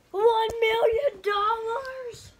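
slow, stunned and high; shocked. Acted. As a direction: gasp it out in disbelief. Shocked at the prize.

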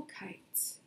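slow, fading whispered close. A narrator's reading. Storyteller trailing off sadly about stuck kites, slow whisper. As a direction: let it sink away into a whisper. Sad line, slowed whisper.

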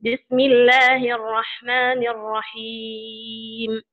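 usual speaking voice

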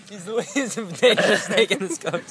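laughing mock Aussie line. Real, not acted. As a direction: say it while cracking up. Mocking accent attempt, laughing.

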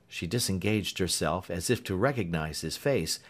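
plain reading voice

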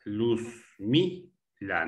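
"Luzmila" very slow, luz-mi-la with gaps; syllable by syllable. Shown as a demonstration. Teacher showing where the stress falls, syllable by syllable. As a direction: pause between the syllables of "Luzmila". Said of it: "La tónica".

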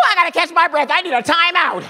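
high, loud hammed-up exasperated mom; mock-frantic mother voice; worn-out mother. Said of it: "when she needed a rest from beating".

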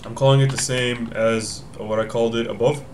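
normal talking voice

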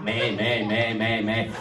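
low mocking bleats; goat bleating. Goat Tom bleats at the farmer, low mocking bleats.

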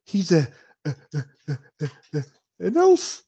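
acted halting disbelief, rising high at the end; shocked stammer. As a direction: stammer in mock horror, building to a high finish. Fainted woman comes to, shocked.

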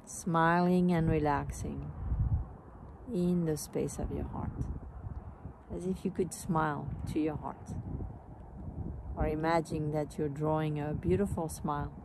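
calm meditation voice, phrases spaced by long pauses, softening as it goes; guided meditation. Real, not acted. Teacher leading a smiling heart meditation, calm and unhurried. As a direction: guide them gently into the heart, letting phrases fade.

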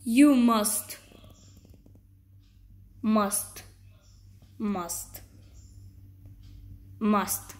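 slow, dropping spooky voice; a hint of a horror villain; dark scary voice. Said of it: "talk in a dark, like, a dark, scary voice".

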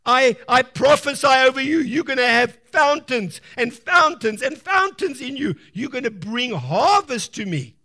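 loud prophetic blessing spoken over a seed; prophesying over the seed. Acted. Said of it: "Speak and say".

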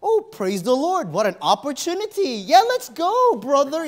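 over-the-top praise voice; mock eager. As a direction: ham up holy enthusiasm. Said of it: "I wasn't like".

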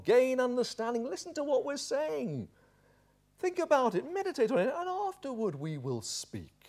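high-pitched exasperated lecturing; exasperated friend. Acted. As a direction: scold from high up, dropping at the end. Voicing Job's exasperated friends.

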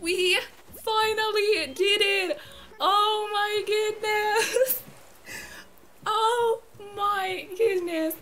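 high, loud, tearful triumph; real choked-up elation, stretching the exclamations; overjoyed. Real, not acted. Gamer celebrating a historical moment with viewers, overjoyed. Long-sought villager finally found.

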